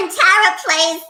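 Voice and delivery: high cartoon villainess impression; Tara as Harley Quinn. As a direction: pitch it up into a rough character imitation. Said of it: "that's a bad impression of".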